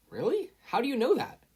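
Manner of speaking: high, eager question; amazed, can't believe it; surprised. A narrator's reading. Izuku surprised.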